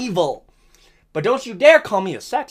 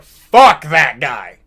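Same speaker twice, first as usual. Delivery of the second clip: slow, lower, booming dismissal; God's verdict; 6 semitones lower, 4 dB louder, much slower than usual. Retold or imitated. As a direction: pronounce it like divine judgment. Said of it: "I like to think God said".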